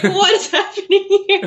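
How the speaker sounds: high mock-baffled cry through laughter; amused, high-pitched mock alarm; laughing bewilderment. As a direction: imitate her confusion, high and giggly. Voices the bewildered woman's reaction.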